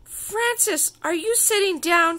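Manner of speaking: high, loud, grand build-up; dramatic announcement. A narrator's reading. Princess calling her friend with big news, high and dramatic.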